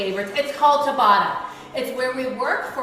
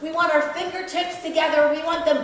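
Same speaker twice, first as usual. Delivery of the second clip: aerobics instructor; 3 semitones higher, 5 dB louder than usual. Real, not acted. Shouted workout cues.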